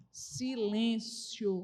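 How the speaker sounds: drawn-out hush. Acted. Preacher to congregation, slow hushed silêncio. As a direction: let the word silêncio hang, slow and low.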